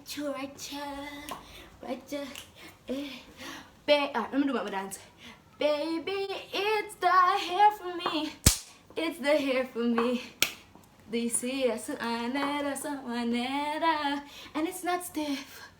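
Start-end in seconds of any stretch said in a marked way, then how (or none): 5.6-10.2 much higher, slow, stretched hair; high drawn-out catchphrase. Pretend. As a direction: show off in a sing-song. Performed catchphrase, then repeated.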